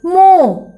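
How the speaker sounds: मो said loud with a long open o; model syllable. Shown as a demonstration. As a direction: stretch मो out clearly and loudly. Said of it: "कैसे होगा उच्चारण?". (मो को ज़ोर से लंबा खींचकर बोलो)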